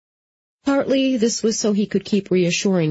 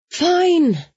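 high, drawn huff of surrender; huffy; 12 semitones higher, 4 dB louder than usual. A narrator's reading. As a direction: give in with a high-pitched huff. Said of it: "she huffed".

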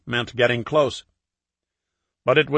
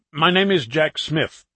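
put-on slow, deliberate London accent; 4 semitones higher than usual. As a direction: spell out the fake name in London accent. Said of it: "in his best London accent".

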